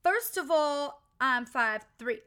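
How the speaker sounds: loud, slow, sassy diva declaration. Retold or imitated. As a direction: belt it out, drawn out and sassy. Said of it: "[name] once said that".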